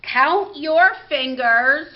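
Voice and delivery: ordinary talk, nothing marked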